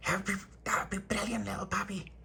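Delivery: high, then trailing into a whisper; hushed childlike thanks. Retold or imitated. Little boy thanking his friend, hushed and high. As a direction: breathe the name softly at the end.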